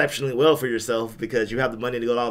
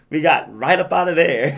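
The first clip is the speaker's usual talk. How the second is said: put-on Canadian accent; 4 semitones higher, 7 dB louder than usual. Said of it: "in a Canadian accent".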